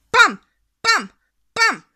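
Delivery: sharp, punchy bangs; popping balloon sounds. A narrator's reading. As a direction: pop each balloon with the voice.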